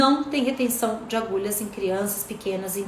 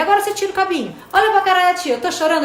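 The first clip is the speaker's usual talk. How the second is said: teasing, higher and louder; playful mock crying; 6 semitones higher, 5 dB louder, much faster than usual. Therapist playfully fakes crying to the boy, teasing. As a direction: pretend to cry to amuse the child. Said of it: "Eu falei".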